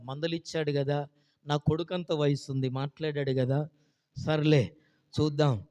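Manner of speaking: ordinary talk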